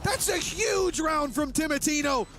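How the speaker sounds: excited live play-by-play call; rising high hype over the clutch; esports caster. Acted.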